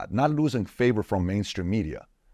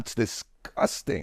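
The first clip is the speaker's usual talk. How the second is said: ordinary talk, nothing marked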